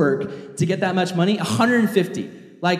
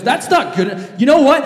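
rising, louder threat; mock menace; 7 semitones higher, 4 dB louder than usual. Creditor turning on the pleading debtor, mock menace. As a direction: wind up to a threat. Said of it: "the guy goes".

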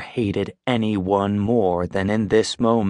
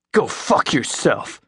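spat; 4 semitones higher than usual. A narrator's reading. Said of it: "he spits".

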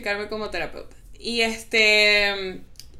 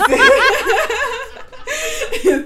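big laugh; 8 dB louder than usual. Real, not acted. Speaker laughs at the joke.

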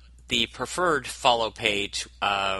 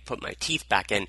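ordinary delivery

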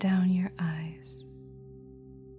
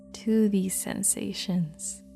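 normal talking voice

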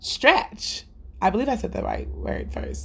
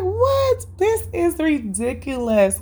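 high, indignant "What?" then slower; outraged mother can't believe it; a mother stunned by her kids' objection; shocked disbelief; 9 semitones higher, slower than usual. Retold or imitated. Mom reacts to children's objection.

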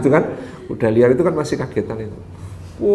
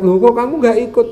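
surprised, weeping neighbours; 9 semitones higher, 4 dB louder than usual. Acted. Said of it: "tetangga-tetangga itu datang ke rumah itu nangis".

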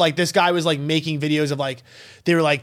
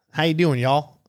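light Southern drawl on a greeting; a mild Southern accent, friendly; 3 semitones lower than usual. Shown as a demonstration. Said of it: "Oh, that's actually good".

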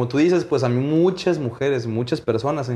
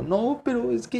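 mock tearful protest; 10 semitones higher, 5 dB quieter than usual.

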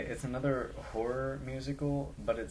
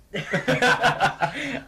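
loud high laugh; 13 semitones higher, 14 dB louder than usual. Real, not acted. Reacts to co-host's joke.

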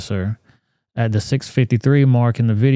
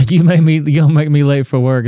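loud, pitched-up mock yell of the officer; angry yell; 3 semitones higher, 4 dB louder than usual. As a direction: yell it like the officer scolding him. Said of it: "is what she said".

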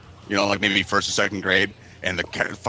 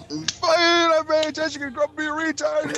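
wigged-out mom. Retold or imitated. Mom back home from the counselor, imitated furious shriek. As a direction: wail it like a mom losing it.

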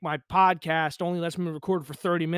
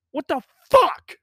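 higher, louder, slow disbelieving shout; outraged shout; 9 semitones higher, 8 dB louder, slower than usual. Real, not acted. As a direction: throw it out in loud disbelief. Outraged rhetorical outburst.

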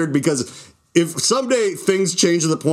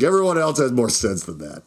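ordinary delivery

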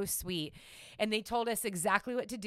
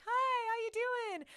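mock sweet greeting; 14 semitones higher than usual. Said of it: "we're like".

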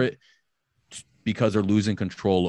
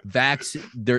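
usual speaking voice

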